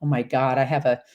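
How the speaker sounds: ordinary delivery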